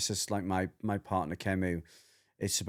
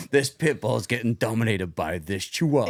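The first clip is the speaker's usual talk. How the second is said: loud, hammed-up intro voice-over; mock show announcer; 6 dB louder than usual. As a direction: announce it like a dramatic dog show trailer. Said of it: "the guy with the deep voice".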